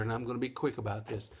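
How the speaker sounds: quick, rattled-off patter; fast auctioneer talk. Real, not acted. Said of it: "try to talk like a little auctioneer".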